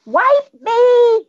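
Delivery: put-on high, loud, drawn-out kid's call; imitated bathroom yell; child yelling. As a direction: holler it out like a small child on the toilet. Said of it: "he would yell".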